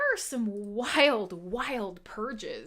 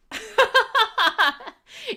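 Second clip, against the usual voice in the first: loud, high burst of laughter; laughing; 12 semitones higher, 11 dB louder than usual. Real, not acted. Host amused at butchering a viewer's name, loud laughing. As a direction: crack up at your own joke.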